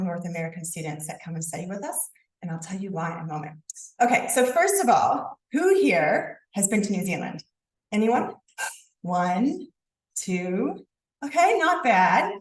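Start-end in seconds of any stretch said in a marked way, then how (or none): none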